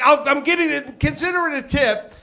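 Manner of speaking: high-pitched acted comeback; exasperated arguing. Customer haggling with the counter guy, high and exasperated. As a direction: argue back with the pitch up.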